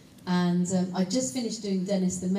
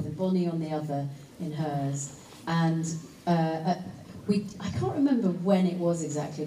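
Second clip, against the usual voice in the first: ordinary talk, nothing marked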